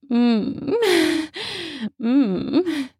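mm mm, savoring moans with mouth full; moaning while chewing. A narrator's reading. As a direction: hum with pleasure over food. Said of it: "she moans while chewing".

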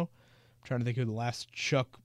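ordinary talk

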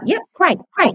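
high, chirpy imitation of an eager dad. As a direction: bounce up like a dad glad to help. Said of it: "he'd be like".

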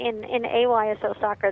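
ordinary delivery

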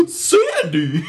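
high, loud gasped Sandy!; mock Zuko shout. Host mocking a friend's Zuko impression with a loud high yell. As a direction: gasp and yell the name like Danny Zuko. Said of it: "an impression of your impression".

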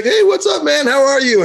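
high, singsong hello; friendly greeting. Retold or imitated. Pool guy greeting his friend, high and friendly.

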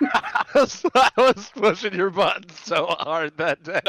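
laughing. Real, not acted. Friend laughing while confessing to the group. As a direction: giggle it out high and breathy with glee.

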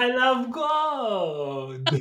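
put-on very high, slow, loud Dutch-accented cry; Goldmember impression. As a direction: echo the greedy cartoon Dutchman, stretched out. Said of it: "A Dutch voice?".

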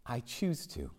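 very soft, with a long pause; hushed, deliberate words set apart; God's quiet answer. Retold or imitated. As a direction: let the answer fall softly, piece by piece. God's answer, repeated softly.

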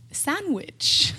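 exaggerated, high Sandwich; mimed sandwich word. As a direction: say Sandwich like mime object work. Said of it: "I have to be like".

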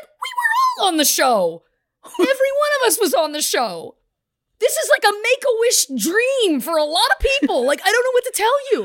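high, excited disbelief; a hammed-up, giddy rave; incredulous retelling. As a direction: play up your amazement at the fans. Retelling his own reply to fans.

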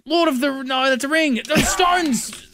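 excited guess. Real, not acted.

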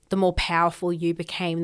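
ordinary talk

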